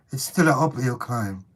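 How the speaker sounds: ordinary talk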